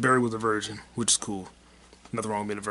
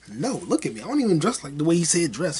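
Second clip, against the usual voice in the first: usual speaking voice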